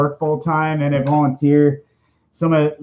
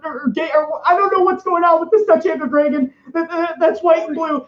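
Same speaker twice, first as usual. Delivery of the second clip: much higher, stammering nervous Morty voice; Morty impression; 12 semitones higher than usual. Streamer does his Morty voice, fretting about a dragon, anxious stammer. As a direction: stutter it out like a frantic, confused teen. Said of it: "an okay impression of Morty".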